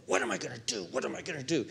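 low, hushed self-questioning; wrestling inwardly. Acted. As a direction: act out agonizing to yourself under your breath. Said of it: "wrestling with something at a passionate".

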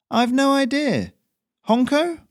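very high, loud cheery courier; breezy and carefree, up high; Ferrino's high voice. Acted. Messenger answers the local, very high and breezy.

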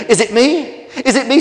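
high, loud, hopeful pleading; excited storybook rival; eager clamour. Acted. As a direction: jump in excitedly, desperate to be picked. Rivals each hope to be chosen.

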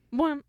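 imitated music hit. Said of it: "the music did the bwamp".

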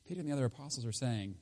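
ordinary delivery

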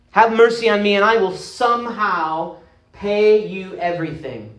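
high, pleading cry for mercy; a slave on his knees, pleading; begging for mercy. Acted. Slave begs king for mercy.

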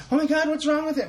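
high panicked cry; mock panic. Imagined crew freaking out.

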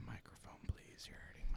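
soft breathy whisper. Real, not acted. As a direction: whisper so the mic won't clip. Whispered complaint about mic noise.

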